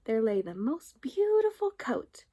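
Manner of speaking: ordinary delivery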